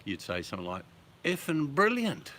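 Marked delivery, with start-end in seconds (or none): 1.2-2.3 high, loud, drawn-out delight; amazed exclamation. Acted. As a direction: marvel slowly and loudly at something extraordinary. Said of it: "something extraordinary".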